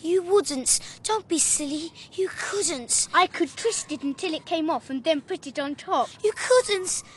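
heated quarrel. Acted. Boys quarrel, threatening and pleading.

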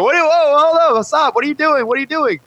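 very high, alarmed halt; startled researchers. Retold or imitated. Researchers catching the boy throwing manure, shocked and high. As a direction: shriek out a shocked stop.

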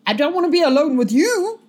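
high, loud protest; indignant rebuff. Acted. Guard rebuffs stranger offering company, indignant. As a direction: blurt it out, pitch jumping up.

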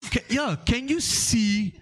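put-on high, slow, quizzing question in a Nigerian accent; Nigerian professor voice. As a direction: lecture the child, drawing it out. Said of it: "the guy goes".

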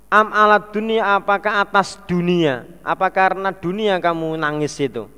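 ordinary delivery

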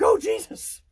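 high startled shriek; frightened yelp. Retold or imitated. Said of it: "I was like".

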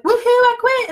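high, loud cheer of her past self; mock whoop. Retelling the day she quit her job, in a high loud cheer. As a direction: whoop it out like she's just quit.

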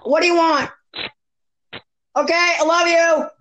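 high-pitched Irish attempt turning British; botched Irish accent. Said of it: "That was British".

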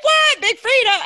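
very high, loud disbelieving squeal; surprised. Retold or imitated. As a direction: shriek it in happy disbelief. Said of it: "I was like".

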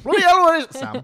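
mock exaggerated Sam. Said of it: "Sam was like".

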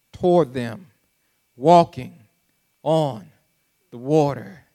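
paced out, dramatic Bible reading; dramatic scripture reading. Acted. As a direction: let each word land.

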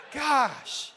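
a burst of real laughter; laughing at his own story; cracking up, then Gosh. Real, not acted.

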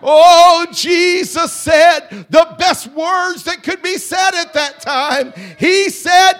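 high, loud, half-sung preacher's whoop; full-force preaching. Acted. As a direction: lift the crowd toward the climax.

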